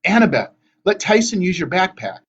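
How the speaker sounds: urgent. A narrator's reading. Percy urgently handles sick Tyson.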